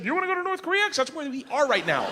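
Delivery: high-pitched sarcastic jab; mock the wife sharply. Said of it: "You should have said".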